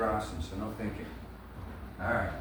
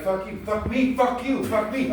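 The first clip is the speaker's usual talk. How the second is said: raised, higher-pitched mock sparring; acted heated exchange; 7 semitones higher, 4 dB louder than usual. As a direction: trade the insults like two actors mid-scene. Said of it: "they might be like".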